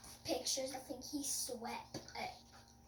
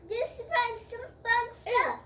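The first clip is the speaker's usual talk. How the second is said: loud call; 11 semitones higher, 11 dB louder than usual. Real, not acted. Child shouting a guess about spring, loud. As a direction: blurt it out eagerly.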